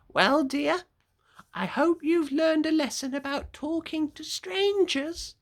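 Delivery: high, kindly granny voice; gentle old grandmother. Acted. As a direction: gently chide like a loving grandma.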